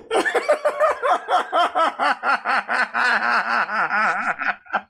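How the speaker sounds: real, high, long burst of laughter that fades out. Real, not acted. Host laughing at his own accidental accent, real high laugh. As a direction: crack up at yourself and let it die away.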